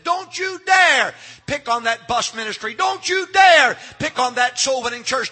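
fierce, rising warning from the pulpit; thundering divine warning. Acted. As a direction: hammer each DARE, long and high. God warning critics, shouted refrain.

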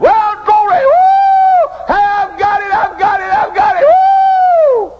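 very high, loud whooping; shouting for joy. Retold or imitated. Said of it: "he jumped up and shouted".

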